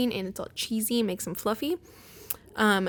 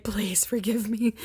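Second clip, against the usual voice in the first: imitating a drawn-out, panicky plea; mock begging for forgiveness; panicked pleading. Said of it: "panicked and was like".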